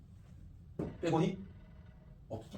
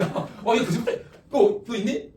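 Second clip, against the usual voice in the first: loud, higher-pitched teasing accusation; mock disbelief; 5 semitones higher, 6 dB louder, slower than usual. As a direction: playfully call out a fib, voice jumping up. Teasing student over claimed diamond ring. (거짓말 말라며 장난스럽게 높고 크게)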